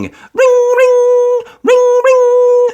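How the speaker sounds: high, loud ring-ring imitation; phone ringing. A narrator's reading. Narrator imitating the station phone, high ringing. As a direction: sing out the phone bell.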